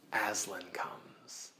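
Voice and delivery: slow whispered reveal, barely voiced; hushed whisper. A narrator's reading. Teacher whispering to the class as Aslan arrives. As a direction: breathe the name like a secret. Dramatic moment in the story.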